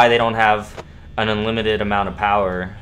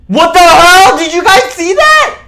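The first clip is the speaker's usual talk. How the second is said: very high, loud shocked scream; 14 dB louder than usual. Real, not acted. Streamer asking chat about the movement, shocked scream. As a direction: yelp as something moves on screen. Jump scare while streaming.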